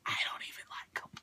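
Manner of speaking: hushed aside; whispered under breath. Real, not acted. Aside about disliked friend.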